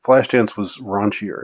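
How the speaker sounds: usual speaking voice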